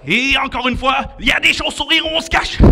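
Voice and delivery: high, loud and quick; mock bat alarm. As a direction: shout a playful warning. Bats again in the tunnel.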